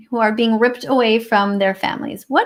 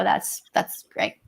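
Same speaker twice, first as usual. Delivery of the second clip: sarcastic whisper; 5 semitones lower, 7 dB quieter, much slower than usual. Real, not acted. Sarcastic aside.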